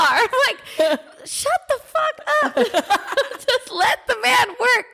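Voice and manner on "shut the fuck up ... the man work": very high squeal through laughter; exasperated. Retold or imitated. Woman to haggling partner over locksmith, high laughing exasperation. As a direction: plead in hammed-up exasperation, laughing.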